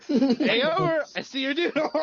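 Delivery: high, loud mock greeting through laughter; laughing imitation. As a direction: barely hold the laugh in while imitating.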